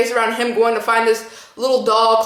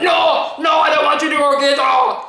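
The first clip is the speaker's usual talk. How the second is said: imitating Arnold Schwarzenegger; 4 semitones higher than usual. Dad on the phone refusing to let neighbor fetch wife, Arnold-style shout. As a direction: bark it out like an angry Austrian action hero. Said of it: "He's like".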